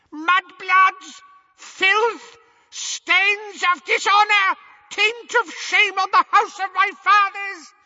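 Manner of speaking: very high, loud screech; screaming. A narrator's reading. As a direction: scream each insult with outrage.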